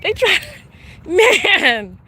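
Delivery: laughing. Real, not acted. Laughing at the rock.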